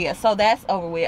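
normal talking voice

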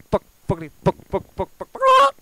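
escalating to a shrieking high scream; mock tantrum. Parody rant boils over.

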